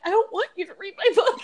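half-laughing mock refusal; laughing protest. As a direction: laugh while pushing them off. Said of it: "I'm like".

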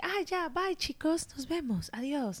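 high, soft, breezy farewell to friends; casual teen goodbye. Retold or imitated. Girl waving off school friends, high and airy. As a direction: play it cool with your classmates. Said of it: "Y yo así de que".

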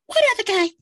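way up high, light voice. Retold or imitated. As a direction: jump into a high head voice. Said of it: "And I'm like".

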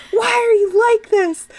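high, pleading hammed-up question; mock-dramatic plea; mock exasperation. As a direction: overplay the despair. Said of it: "He's like".

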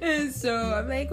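normal talking voice

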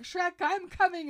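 high, loud mock call to a friend. Donkey answering Shrek's scream, calling out. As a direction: shout it like a retold rescue cry.

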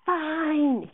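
loud, high angry yell; screamed. A narrator's reading. Director giving up on Miranda, screaming. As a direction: scream it in exasperation. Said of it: "he screamed".

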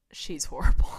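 sneering she's horrible; imitating a hater's scorn; mock disgusted student. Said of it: "Not she's horrible".

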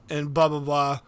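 ordinary talk

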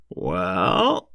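drawn-out rustic "well", sliding up; firm. A narrator's reading. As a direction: farmer weighing in decisively. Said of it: "he said firmly".

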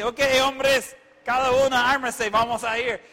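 rallying command. Acted. Abraham arming his servants, rallying command. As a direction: order the men out, loud and high.

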